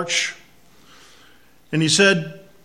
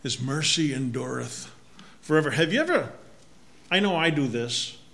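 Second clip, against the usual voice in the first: ordinary delivery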